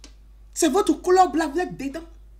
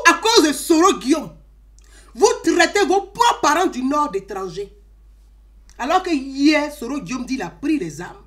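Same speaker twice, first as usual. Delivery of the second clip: ordinary talk, nothing marked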